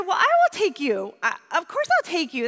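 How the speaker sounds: high, forceful retold reassurance; emphatic offer. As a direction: insist on helping, voice jumping up.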